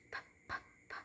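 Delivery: "p" in tiny breath-only pops; unvoiced P. Shown as a demonstration. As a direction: puff out "p" softly without voice. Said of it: "P sound has no voicing".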